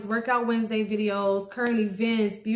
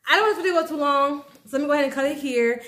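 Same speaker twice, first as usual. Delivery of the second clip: fast, a bit louder, hurrying to end; rushed wrap-up; 4 semitones higher, 4 dB louder, much faster than usual. Real, not acted. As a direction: speed through it to cut the video.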